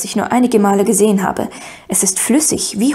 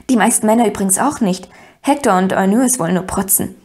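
plain reading voice

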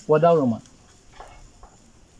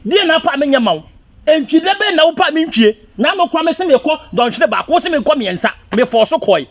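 high, worked-up complaint; angry accusation; 9 semitones higher, 3 dB louder than usual. Acted. Husband airing grievances to his wife, heated and high. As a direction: pile up grievances in a raised pitch.

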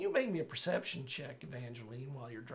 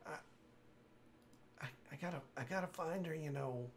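weak, urgent dying man; 3 semitones higher than usual. Acted. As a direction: plead with fading breath. Dying NPC gasping urgent.

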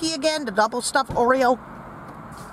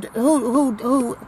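stuttering; 3 dB louder, much slower than usual. Real, not acted. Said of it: "stop stuttering".

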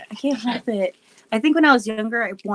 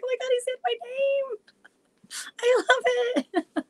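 very high, squealing through laughter; giggly delight. Real, not acted. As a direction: gush like a thrilled fan, laughing. Delighted retelling, breaking into laughter.

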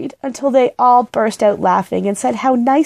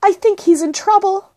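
put-on high, worried girl's call; pitched-up, anxious girl; urgent cry; 12 semitones higher than usual. A narrator's reading. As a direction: call it back high while rushing off. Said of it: "cried Judy over her shoulder".

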